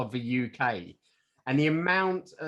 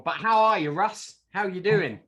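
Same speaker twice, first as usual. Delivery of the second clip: louder, higher, upbeat show host; host greeting; 7 semitones higher, 6 dB louder than usual. Acted. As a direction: greet your co-host like live radio.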